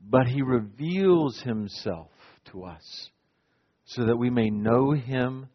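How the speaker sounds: low, soft and slow; hushed reverence. Real, not acted. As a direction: draw them in with a near-whisper. Preacher drops to a hush.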